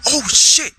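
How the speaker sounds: high, loud cry of alarm; shocked outburst. Retold or imitated. Telling of finding the front door open, shocked outburst. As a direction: re-enact a startled yell, voice jumping up.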